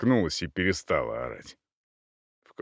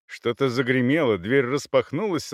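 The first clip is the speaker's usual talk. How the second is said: ordinary delivery, nothing marked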